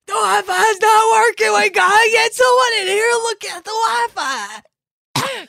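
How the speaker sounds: high, loud hammed-up yell; mock panicked shout. Chimney sweep girl yelling about the Wi-Fi, a high mock shout. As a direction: yell for someone to fix the internet. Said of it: "being like".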